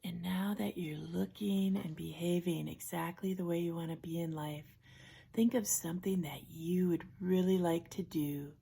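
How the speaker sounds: soft, unhurried relaxation-coach voice with long pauses; guided imagery. Acted. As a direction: gently guide them into a safe place, hushed and calm. Leads a guided imagery exercise.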